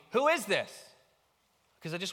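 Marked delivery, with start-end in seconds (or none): 0.1-0.7 loud, high, punchy curiosity; curious question. Shown as a demonstration. Preacher showing the question asked out of curiosity, curious. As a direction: ask it eagerly, raised and loud. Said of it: "Out of curiosity".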